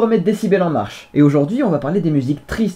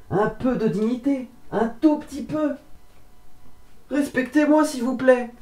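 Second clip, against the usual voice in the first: high, soft, pleading whine; mock pleading; 9 semitones higher, 4 dB quieter than usual. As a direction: beg for dignity. Pleading for a bit of dignity.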